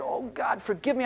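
near-whispered oh God, forgive me; a softly ashamed prayer after healing; hushed repentance. Retold or imitated. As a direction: breathe it out in contrition.

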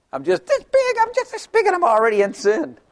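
put-on very high, small baby voice; tiny baby voice. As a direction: squeak it like a baby in the womb. Voicing a tiny unborn baby.

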